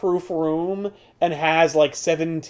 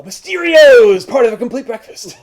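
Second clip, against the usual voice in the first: cereal mascot; 6 semitones higher, 7 dB louder than usual. Pretend. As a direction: sell it like a cereal commercial. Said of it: "it sounds like he's a breakfast cereal".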